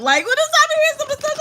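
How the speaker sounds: ordinary talk, nothing marked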